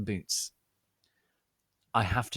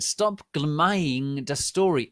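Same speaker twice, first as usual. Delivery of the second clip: angry scold; 7 semitones higher, 8 dB louder, slower than usual. Acted. Author scolding the flamers.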